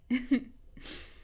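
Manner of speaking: short, mostly voiceless chuckle; a quick rueful laugh at yourself; breath of laughter after a confession. Real, not acted.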